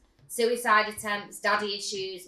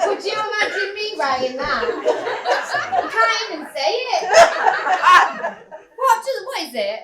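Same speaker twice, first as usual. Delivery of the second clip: higher, louder laughing protest; laughing at not reading the word; 11 semitones higher, 10 dB louder than usual. Real, not acted. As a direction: laugh through the words. Laughing embarrassment.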